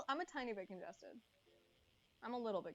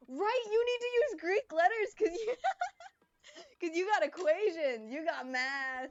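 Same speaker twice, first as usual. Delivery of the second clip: laughing rant; 6 semitones higher, 9 dB louder than usual. Real, not acted. Laughing at math symbols.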